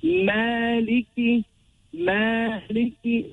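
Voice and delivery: Maliki slow, long maa and clear ki; two-count mad. Shown as a demonstration. Teacher showing the short mad, Maliki slow and measured. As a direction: hold the maa of Maliki, finish on a distinct ki. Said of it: "this mad only two".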